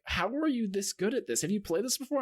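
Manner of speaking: high-pitched, imitated astonished questioning; retold amazement. Player retelling everyone's reaction to his VR skill, imitated amazement. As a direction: play the amazed onlookers, pitch up.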